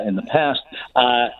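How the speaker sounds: usual speaking voice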